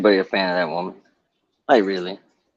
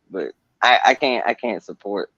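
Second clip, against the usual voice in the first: ordinary talk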